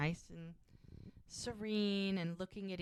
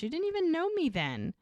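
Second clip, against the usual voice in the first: pitched way up in mock amazement; surprised; 11 semitones higher than usual. Mel amazed that Jack loved her early, high mock surprise. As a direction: squeal it out, touched and amazed.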